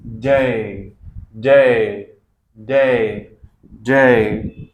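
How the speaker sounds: "डे" said loudly as day with an ei glide; loud repeated model. Shown as a demonstration. Teacher loudly drilling a word for students. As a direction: call out "डे" over and over. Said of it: "इसको क्या बोलेंगे हम?".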